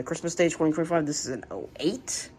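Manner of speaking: normal talking voice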